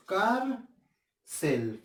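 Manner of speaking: "Cárcel" split into cár - cel with a pause; "Cárcel" counted out as cár, then cel; syllable by syllable. Shown as a demonstration. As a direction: separate the two syllables of "Cárcel". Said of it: "¿Cuántas sílabas hay en cárcel?".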